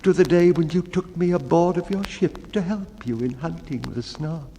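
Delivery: high, meek storyteller voice; the mildest of men pleading to be heard; timid baker. Acted. Baker recounting his sad story to the bellman, high and meek.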